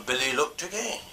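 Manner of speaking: ordinary delivery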